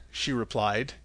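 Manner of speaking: plain reading voice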